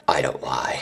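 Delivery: unvoiced joking whisper. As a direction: whisper it as a sly aside. Aside whispered to viewers.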